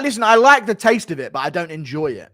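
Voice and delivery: normal talking voice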